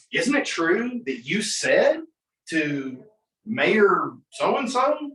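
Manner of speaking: slow, drawn-out accusation climbing in pitch; cross-examining attorney. Acted. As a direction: lead the witness into a trap, stretching each phrase. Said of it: "on cross-examination, it's like".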